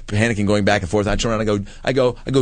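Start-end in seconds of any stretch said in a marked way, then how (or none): none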